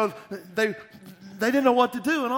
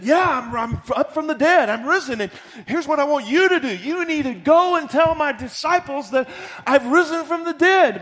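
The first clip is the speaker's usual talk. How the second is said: much higher and louder triumphant voice; joyful proclamation; 11 semitones higher, 12 dB louder than usual. Acted. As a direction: proclaim the resurrection. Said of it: "and Jesus said".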